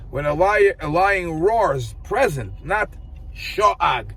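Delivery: ordinary delivery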